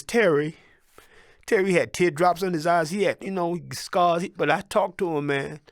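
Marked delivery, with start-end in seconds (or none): none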